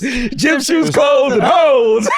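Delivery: high, loud, drawn-out holler; shouted punchline. Real, not acted. Comic repeats what he bought with drug money, gleeful shout. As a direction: belt it out slowly like a slogan, laughing.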